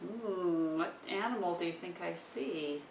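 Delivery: usual speaking voice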